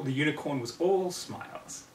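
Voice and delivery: ordinary delivery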